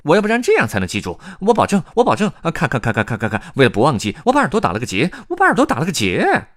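high, loud, flustered hare voice; 慌忙的野兔. A narrator's reading. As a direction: promise frantically in a squeaky animal voice. 野兔慌忙保证记住. (高亢慌乱、急着保证的野兔)

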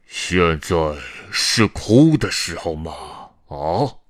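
loud, imposing scolding; booming spectral reprimand; deep-voiced ghost. A narrator's reading. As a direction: thunder a rebuke as a ghost. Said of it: "鬼魂用低沉的声音问道".

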